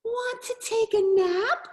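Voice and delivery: high-pitched cat question. A narrator's reading. Cat inviting the spider to nap, high cat voice. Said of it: "cried the cat".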